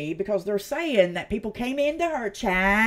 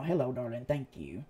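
ordinary talk

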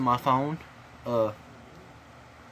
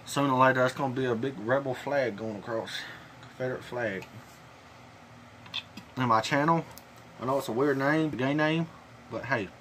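talking around lip pouch; with dip in lip. Real, not acted. Seen 5: Lower lip looks bulged throughout; holds a spit-style plastic bottle.

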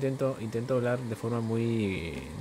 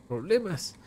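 surprised; 8 semitones higher, 4 dB louder, slower than usual. Acted. Game character reacts in dialogue.

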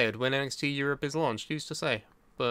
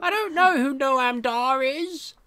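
1 mock clueless voice; 10 semitones higher, 6 dB louder than usual. Mocking wrestling jargon.

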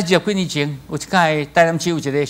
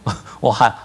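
normal talking voice

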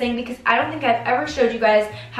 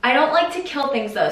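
ordinary delivery

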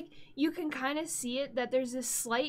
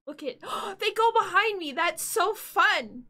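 excited; 8 semitones higher, 10 dB louder than usual. Real, not acted. Delighted by emotes behind her.

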